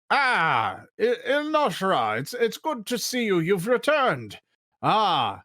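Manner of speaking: high-pitched, pleased gnome greeting; delighted gnome doorkeeper. Acted. Gnome doorkeeper greeting Il Noshra on her return, delighted and high.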